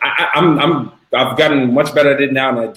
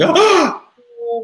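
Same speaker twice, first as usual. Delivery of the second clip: gasp, then a much higher startled "Oh!"; freaked-out gasp. Retold or imitated. Client shocked by first sale, gasping "Oh". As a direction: act out a new seller panicking. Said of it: "they actually freak out".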